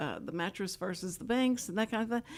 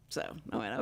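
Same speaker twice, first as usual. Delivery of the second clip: ordinary talk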